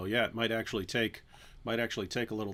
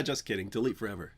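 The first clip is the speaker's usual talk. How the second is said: normal talking voice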